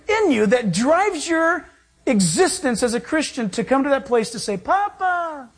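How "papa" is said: high, drawn-out cry of Papa. Acted. The Holy Spirit cries out inside a believer, crying out. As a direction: cry out to a father, pitched high. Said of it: "The Holy Spirit cries out".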